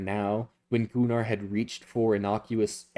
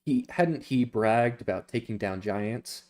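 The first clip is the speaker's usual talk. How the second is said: plain reading voice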